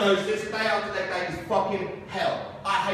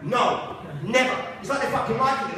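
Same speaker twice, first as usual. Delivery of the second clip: ordinary talk, nothing marked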